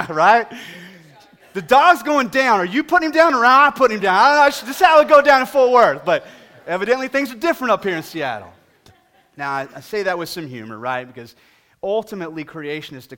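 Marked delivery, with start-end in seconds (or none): 1.5-8.5 loud, high comic bluster; mock tough Texan. Preacher joking how Texas would handle the dog, loud bluster. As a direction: play the Texan who won't stand for it.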